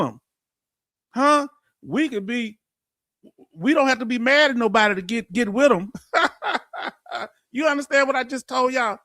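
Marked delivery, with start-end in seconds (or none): 6.0-7.2 laugh. Real, not acted. Laughs after a Texas boast.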